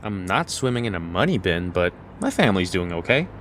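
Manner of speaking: ordinary delivery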